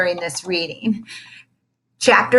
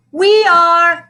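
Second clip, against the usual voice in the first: put-on high, loud kids calling out; eager cry; 10 semitones higher, 8 dB louder than usual. A narrator's reading. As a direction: shout it together. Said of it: "cried Ashley A and Ashley B".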